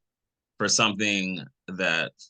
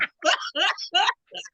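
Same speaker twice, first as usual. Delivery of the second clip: high laugh. Real, not acted. Amused by the swallowed-up joke, laughing hard. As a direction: crack up at the joke.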